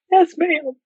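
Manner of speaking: high, small obedient voice; meek mock reply. Helper with hand in patient answers order, high and meek.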